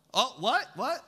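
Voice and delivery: high, questioning double take; mock surprise. Preacher pretending to discover his misquote, mock surprised. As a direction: feign being caught out by the verse.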